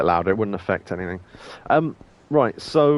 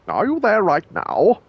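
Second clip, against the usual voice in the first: surprised; 10 semitones higher, 5 dB louder than usual. Acted. Surprised question, high and loud.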